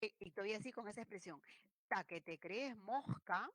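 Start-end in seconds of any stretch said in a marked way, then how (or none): 1.9-3.5 "mosca" with the s turned into a breathy h; "Ta que te crees mosca", the s breathed out; aspirated s. Retold or imitated. Teacher mimicking a speaker, aspirated "mosca". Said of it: "con la aspirada".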